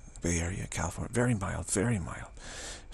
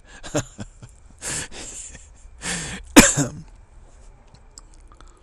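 amused laugh trailing on, then bursting louder; real laugh; 6 semitones higher, 6 dB louder than usual. Real, not acted. Speaker amused by the mother giving up, real laugh. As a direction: laugh at your own story. Laughs after his story's punchline.

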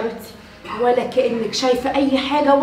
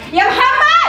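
loud high call for Mohamed; calling Mohamed through the closed door; 9 semitones higher, 3 dB louder, much slower than usual. Acted. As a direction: shout his name through the door.

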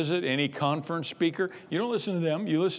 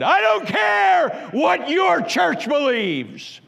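booming slow shout, much higher and louder; slow commanding roar from on high; authoritative voice; 12 semitones higher, 9 dB louder, much slower than usual. Retold or imitated. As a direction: thunder it out like the voice of God. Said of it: "in the most authoritative voice he had ever heard".

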